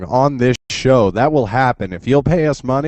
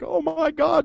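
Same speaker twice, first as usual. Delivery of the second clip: much higher-pitched mock panic; mock freaking out. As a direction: imitate fans freaking out. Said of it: "like, freaking out".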